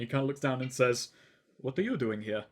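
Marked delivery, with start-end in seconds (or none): 1.6-2.4 lower, quieter and looking down on him; softly voiced snooty elf doorman; haughty elf butler. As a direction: speak down from seven foot of disdain. Towering elf butler addresses orc.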